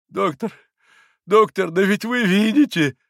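high pleading, rising to a loud cry; anguished high-pitched protest; despairing. A narrator's reading. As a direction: appeal to the doctor in despair. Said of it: "указывая в отчаянии".

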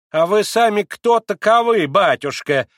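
put-on high, loud old servant woman asking; old woman's changed voice. A narrator's reading. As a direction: peer at him in the dark as an old maid. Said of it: "совсем другим голосом проговорила старушка".

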